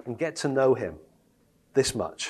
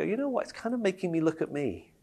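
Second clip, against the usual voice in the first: quiet realization; 7 dB quieter than usual. Retold or imitated. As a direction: let it dawn on you quietly. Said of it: "you go".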